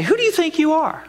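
mock outraged woman; angry retort. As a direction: fling it back in offence. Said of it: "She might be angry and say".

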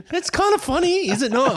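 high giggling through the words; cracking up at the news; laughing. Real, not acted. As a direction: laugh while you say it. Laughing at Trump having covid.

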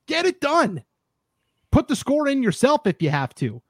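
worked up, pitch riding high; exasperated rant, jumping high on the demands; aggravated. Real, not acted. Host fed up with a governing body that won't govern, aggravated. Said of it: "this is what's aggravating to me".

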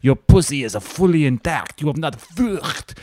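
doctor voicing a French exclamation, mock accent. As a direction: play a cheesy French gynecologist. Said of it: "then he's like".

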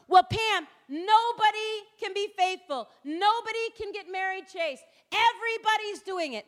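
high, dismissive know-it-all voice; mocking the world. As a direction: parody the culture's shrug that nobody can be faithful. Mocking the world's typical excuse.

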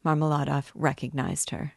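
usual speaking voice

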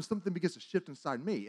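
normal talking voice

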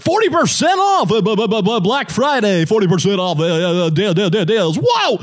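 high, loud ad announcer hype; hyped sale ad voice. Retold or imitated. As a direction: yell the deals like a commercial.

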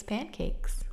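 whispered Pancakes, breathy and secretive. Shown as a demonstration. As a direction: breathe the title playfully. Playful whispered repeat of title.

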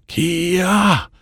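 high belly yell from the gut; karate yell. Acted. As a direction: let out a sharp kiai. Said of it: "show them your yell".

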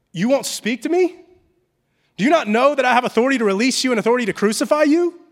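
acted indignant disbelief; indignant Pilate. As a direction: demand an answer as the man in authority. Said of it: "hear the tone here".

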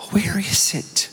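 quiet Mississippi mother's question; Mississippi accent. Retold or imitated. Mom asking her son where it is, soft Mississippi accent. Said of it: "in her Mississippi accent".

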